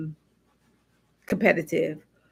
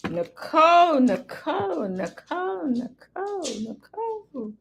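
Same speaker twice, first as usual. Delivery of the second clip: gentle chiding; 8 semitones higher than usual. Real, not acted. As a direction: chide her by name, trailing down in volume. Chiding the client during reading.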